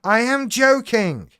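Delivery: hammed-up protest that he is joking; insistent joking protest. Host protesting to his other self that he is joking. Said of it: "He isn't fucking joking".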